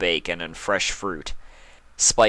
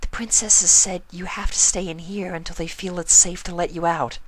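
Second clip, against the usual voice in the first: shy and apologetic; Fluttershy voice; 11 semitones higher than usual. Acted.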